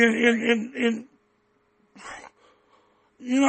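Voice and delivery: usual speaking voice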